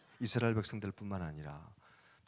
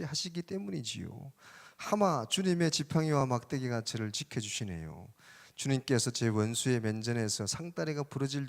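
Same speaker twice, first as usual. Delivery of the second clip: put-on folksy Chungcheong-accented psalm recitation; Chungcheong dialect; 3 semitones higher, 4 dB louder than usual. Preacher performs Psalm 23 for the congregation, in Chungcheong dialect. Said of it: "충청도 버전 아시는 분 많이 들어봤겠지만".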